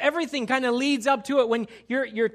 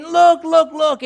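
loud, eager showing off; imitating the proud fiancée, thrilled; excited look look; 6 semitones higher, 11 dB louder, slower than usual. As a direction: point at it, bursting with pride. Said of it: "and you're saying".

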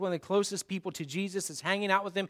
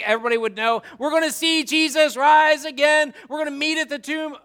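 louder, higher, rallying the praise team; hyped-up organizer; 4 semitones higher, 8 dB louder than usual. Pretend. Pastor hyping his team for the tomb, excited. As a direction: pump up the crew for sunrise.

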